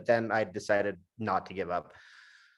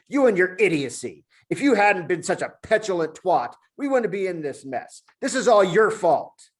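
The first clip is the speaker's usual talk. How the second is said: angry rant; 8 semitones higher, 6 dB louder than usual. Acted. Julian rants at the monkey, loud and furious. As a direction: lay every bit of blame on him.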